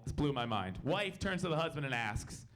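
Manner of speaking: usual speaking voice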